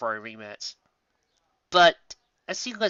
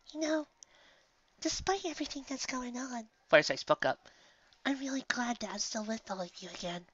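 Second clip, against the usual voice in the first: much softer and higher, timid; shy, tender gladness; Fluttershy; 10 semitones higher, 6 dB quieter than usual. Acted. Fluttershy voiced in story.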